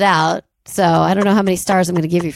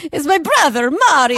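Jared Leto; 6 semitones higher than usual. Retold or imitated. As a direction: ham up a cartoonish Italian accent. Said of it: "It is Jared Leto".

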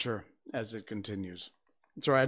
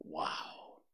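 usual speaking voice

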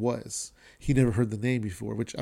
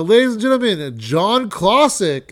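host's introduction; 7 semitones higher, 8 dB louder than usual. Retold or imitated. Host bringing the headliner on stage, big announcer introduction. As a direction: announce the act to the room, imitated. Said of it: "the host, Aurora, was like".

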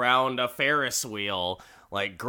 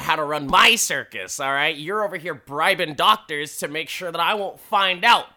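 mock-angry, building louder and higher; irate boss; 4 semitones higher, 6 dB louder than usual. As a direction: lay down the law as ringmaster. Ringmaster tells off an underling.

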